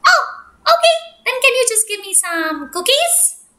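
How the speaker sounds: high, fast, chirpy duck voice. Acted. Duck begging bear for cookie things, high chirpy pleading. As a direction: bounce it up high like a giddy little duck.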